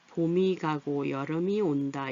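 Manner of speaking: slow "보미 가고 여르미 온다"; unhurried demo linking ㅁ in 봄이 and 여름이; linked reading. Shown as a demonstration. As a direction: read slowly, 봄이 as "보미", 여름이 as "여르미". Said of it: "이렇게 소리가 나요".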